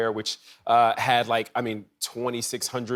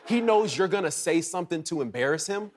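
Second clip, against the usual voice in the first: ordinary talk, nothing marked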